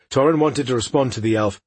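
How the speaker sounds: ordinary delivery, nothing marked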